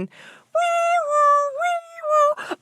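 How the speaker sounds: high siren wail, wee woo; siren noise. Pretend. Host imitating a chasing siren, high wail. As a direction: sing it like a police siren.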